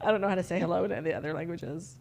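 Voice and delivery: fast, low, soft laughing admission. Real, not acted. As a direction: rush it out through the tail of a laugh. Laughing after running joke.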